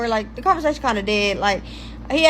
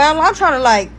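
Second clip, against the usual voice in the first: normal talking voice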